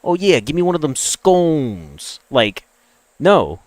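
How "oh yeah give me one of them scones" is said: slow, hammed-up Brooklyn accent; imitating a Brooklyn guy asking for scones; mock Brooklyn accent. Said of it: "the American Brooklyn accent that would be like".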